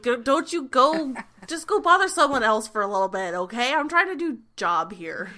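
loud, exasperated telling-off; Brandon, really loud. Acted. Brandon shooing away the prankster while working, loud and fed up. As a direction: scold a pest at high volume. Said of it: "really loud. It's like".

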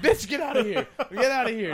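loud laughing yell, high and loud, shooing her off; half-laughing shout, get away from me. Retold or imitated. Telling off the girl at the bar, a laughing shout.

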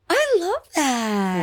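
gasp, then a high, slow I love that; thrilled at the name, drawn out; delighted gasp. Real, not acted. Cohost suggests a fake name, delighted gasp.

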